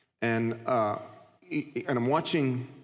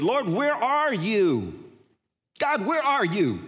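high, slow, louder plea; loud cry to God; 9 semitones higher, 3 dB louder, slower than usual. Retold or imitated. A frustrated pastor crying out loudly to God. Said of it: "loudly said".

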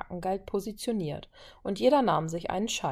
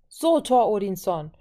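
loud, raised-pitch egging on; calling out; 5 semitones higher, 6 dB louder than usual. A narrator's reading. Violet urges Thor to beat one stroke, calling out. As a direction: call out a challenge to the contestant. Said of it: "rief sie".

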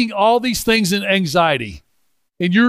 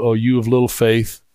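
lower, measured Bible reading; scripture reading; 6 semitones lower than usual. Acted. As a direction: read the passage low and steady. Reads scripture passage aloud.

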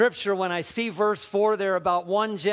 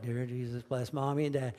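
put-on much softer and lower, a kid's bored mumble; mumbled rote prayer; 6 semitones lower, 7 dB quieter than usual. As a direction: mumble it like a child half asleep. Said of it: "we'd mumble it every day".

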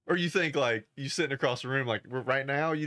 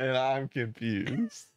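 slow mock confusion; much slower than usual.

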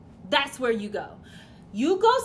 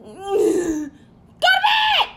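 huge high yelled bedtime order; furious shout; 8 semitones higher, 7 dB louder, much slower than usual. Acted. Fed-up dad screaming bedtime at his child, huge shout. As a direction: blow up at the kid.

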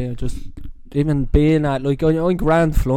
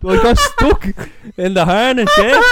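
laughing; 11 semitones higher, 6 dB louder than usual. Real, not acted. Laughing retelling of getting stuck.

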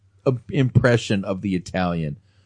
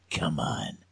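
quoted softly, much quieter; understated challenge; 3 semitones lower, 11 dB quieter than usual. Retold or imitated. Retelling how an unfazed opponent answers trash talk, understated challenge. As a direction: imitate a fighter's quiet dare. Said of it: "the motherfucker goes".

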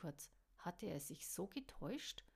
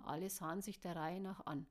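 ordinary delivery

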